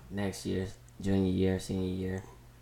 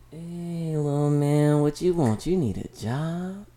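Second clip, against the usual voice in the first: put-on high-pitched, drawn-out counter-girl voice; high mock greeting, stretched at the end; imitated counter girl; 7 semitones higher, 4 dB louder, slower than usual. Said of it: "That's how she said it".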